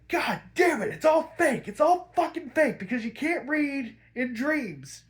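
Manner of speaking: high-pitched exasperated rant, acting out Bruce; mad outburst. Bruce realizing he can't read the newspaper, mad outburst. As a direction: imitate someone furiously realizing it's all fake. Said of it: "absolutely mad".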